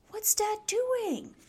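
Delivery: very high, slow question; hushed wonder. A narrator's reading. Snip peeking at Dad through grass, wondering. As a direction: ask in puzzled wonder.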